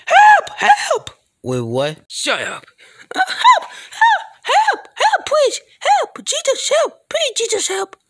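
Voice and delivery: very high, loud squeaky screams for help; frantic cries for help. Acted. High falsetto character begging Jesus for help, shrieking.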